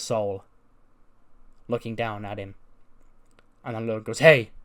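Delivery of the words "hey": a sudden sharp yell of hey; shouted call. Retold or imitated. Logan calling out loudly to the Prime Saurus. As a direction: bark it out to get his attention.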